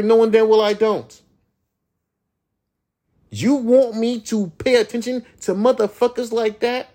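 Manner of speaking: ordinary delivery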